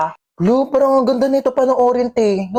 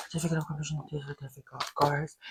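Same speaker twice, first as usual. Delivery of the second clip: mumbled reading; 8 semitones lower, 24 dB quieter than usual. Acted. Reviewing quietly for exam.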